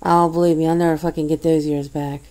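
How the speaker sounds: normal talking voice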